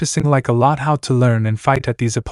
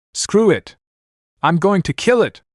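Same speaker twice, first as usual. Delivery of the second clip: shouting; 7 semitones higher than usual. A narrator's reading. Character yells in battle fury.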